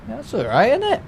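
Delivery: pleased, sing-song lift at the end; delighted. Real, not acted. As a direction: pitch leaps up high on isn't it. Pleased about seeing the plane.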